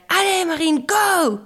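high, rousing shout; cheering encouragement. A narrator's reading. Witches urge Marine to jump, rousing cheer. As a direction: cheer her on, building to a cry. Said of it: "l'encouragèrent les sorcières".

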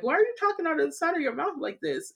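high, quick imitation of puzzled relatives; family's baffled question. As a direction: mimic the family questioning the odd way of talking. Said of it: "you know, they were like".

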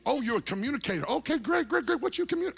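high, quick pestering chatter; mock gushing excitement. Seatmate quizzing the preacher on what he communicates, mock gushing excitement. Imitates seatmate's eager questioning.

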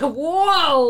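big, loud, drawn-out whoa; exaggerated cry of amazement; awestruck whoa. Acts out being overwhelmed by God.

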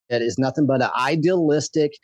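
normal talking voice

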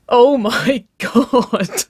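high, loud, drawn out through laughter; helpless laughter. A narrator's reading. Waverley seeing her batter-covered girlfriend, bursting out laughing. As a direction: break up laughing on the words. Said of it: "she laughed".

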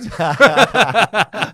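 loud, high belly laugh; big laugh. Real, not acted. Guest cracks up at host's quip about paying, loud real laughter. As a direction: burst out laughing hard.

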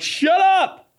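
sudden high shriek of shut up; yelling at stomach. Pretend. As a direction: shout your own belly down. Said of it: "I'm like".

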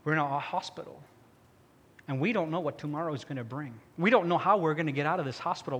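ordinary talk, nothing marked

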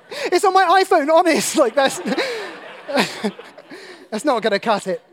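high, loud, laughing through the words; laughing joke. Real, not acted. Joking to congregation about his phone Bible, laughing. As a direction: giggle out the excuse.

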